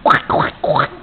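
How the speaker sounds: voiceless, quick duck quacks; duck quacking. Shown as a demonstration. Mother showing her baby what a duck says, voiceless quacking. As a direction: quack like a duck without full voice. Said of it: "What does the duck say?".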